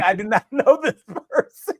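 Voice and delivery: laughing through the denial. Retold or imitated. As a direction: chuckle mid-sentence in disbelief. Retelling absurd police question.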